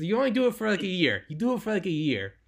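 high, put-on Israeli accent, not very convincing; attempted Israeli accent. Quoting an Israeli ex-soldier on a one-year contract, in a mock Israeli accent. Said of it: "I can't do an Israeli accent".

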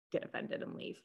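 dropped to a whisper. Real, not acted. Roasting the spirit.

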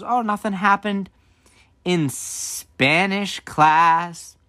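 high start, very drawn-out ending; knowing mom dragging out each word; leading question. Retold or imitated. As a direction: stretch out the hint, mock-innocent. Mom hinting that she knows.

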